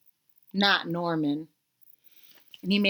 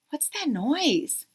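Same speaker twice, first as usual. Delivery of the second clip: ordinary delivery, nothing marked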